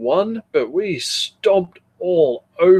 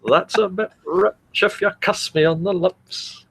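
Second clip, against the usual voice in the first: mock New Zealand accent. Comedy bit mocking Kiwi accent.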